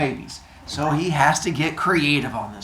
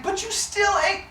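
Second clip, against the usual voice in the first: a high thin gasp of disbelief; shocked mother. Retold or imitated. Rhea seeing the second baby vanish, very high disbelief. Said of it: "She's like".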